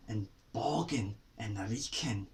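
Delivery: breathy whisper on "boggin" and "reeking"; unvoiced whisper of the words. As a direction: hush it like a secret. Listing silly Scots words.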